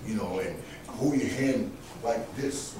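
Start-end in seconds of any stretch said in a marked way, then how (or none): none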